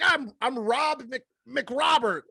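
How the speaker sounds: high, slow goofy name voice; goofy introduction. Retold or imitated. Joking about silly names in a high goofy voice. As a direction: introduce yourself like a clueless oaf.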